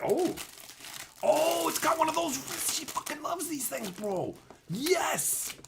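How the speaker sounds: high, soft pleased gushing; delighted. Real, not acted. Finding a gift his partner loves, high soft delight. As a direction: gush over the gift for her.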